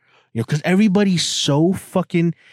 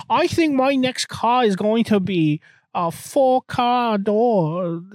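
mock dumb voice; 8 semitones higher, slower than usual. Mocking quote, silly voice.

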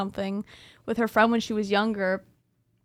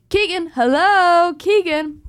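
eerie ghost voice calling; 6 semitones higher, 7 dB louder, slower than usual. Retold or imitated. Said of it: "the way that I described it".

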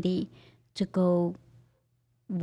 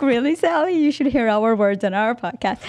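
high, loud giggling tease; laughing, imitating Travis; 5 semitones higher, 6 dB louder than usual. Guest laughing as she imitates the host's teasing. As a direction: giggle through a teasing quote. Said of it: "I see Travis laughing over there like".

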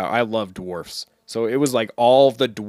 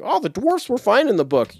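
mocking quote; 10 semitones higher than usual. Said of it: "anybody that's like".

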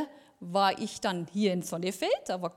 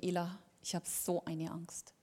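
much softer, lower retold voice; hushed confession; 4 semitones lower, 12 dB quieter than usual. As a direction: quietly imitate someone admitting fear. Colleague confides fear, retold. (leise nachgeahmtes Geständnis)

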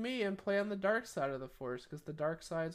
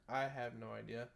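normal talking voice

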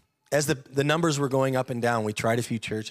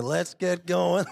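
eager wake-up call; 6 semitones higher, much slower than usual. Retold or imitated. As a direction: rouse the sleepy family, slowly. Rousing family early on Sundays.

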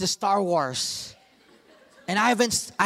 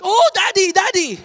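high, loud panicked cry of "Daddy"; panicked child; 11 semitones higher, 7 dB louder than usual. Retold or imitated. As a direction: cry out in alarm like a kid. Son drops the GoPro.

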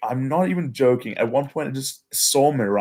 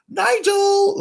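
much higher, loud call across the forest; retold yell; 4 dB louder than usual. Lost on the hike, calling out for his friend Nigel, yelling. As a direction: shout a name into the trees, far-carrying. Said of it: "just randomly yell".

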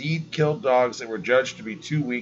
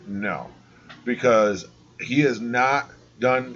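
ordinary talk, nothing marked

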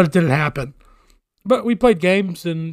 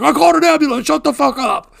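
high, loud shouting back; raised, pitched-up mock shout; retold screaming; 9 semitones higher, 4 dB louder, faster than usual. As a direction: imitate an exasperated cop yelling back. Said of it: "screaming with the cops".

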